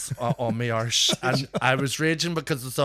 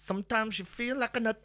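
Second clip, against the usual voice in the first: high mimicked sample; octave-up mix quote; 11 semitones higher, 4 dB quieter than usual. Performer quoting a favourite mix, high imitation. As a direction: recite it in a raised, put-on pitch. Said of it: "it went up an octave".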